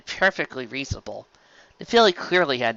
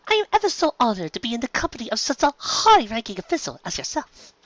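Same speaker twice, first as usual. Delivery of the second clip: higher, elegant flattering lady voice; refined mare; 10 semitones higher than usual. Acted. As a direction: lay on the courtly politeness. Rarity flatters the duke.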